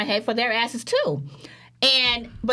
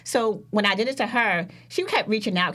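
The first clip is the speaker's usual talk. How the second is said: ordinary talk, nothing marked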